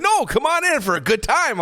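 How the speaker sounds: very high, sing-song come-on; flirty invite. Retold or imitated. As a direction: lure him in playfully. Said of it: "He's like".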